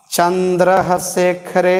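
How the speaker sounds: slow, drawn-out Chandrashekhar, each syllable spaced; teacher slowly sounding out Chandrashekhar. Shown as a demonstration. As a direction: spell out Chandrashekhar slowly for pupils. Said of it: "बस इधर थोड़ा सा ध्यान देना है".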